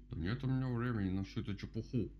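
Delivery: ordinary delivery, nothing marked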